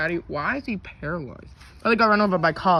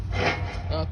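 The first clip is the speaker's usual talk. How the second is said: usual speaking voice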